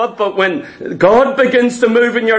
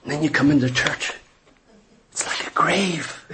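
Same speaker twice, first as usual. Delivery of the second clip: dropping to a whisper for a silent church; hushed; 7 semitones lower, 8 dB quieter than usual. Acted. Preacher mocking the silent church, hushed whisper. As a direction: whisper it like at a grave.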